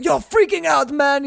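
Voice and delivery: high, loud hammed-up director; pushy director. Director reassuring Tim Robbins, mock pushy.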